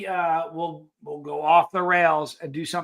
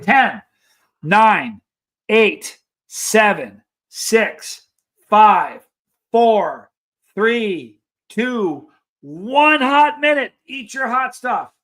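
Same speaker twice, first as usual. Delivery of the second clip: countdown call; 6 dB louder, much slower than usual. Real, not acted. Host launching viewers into the hot food challenge, loud countdown call.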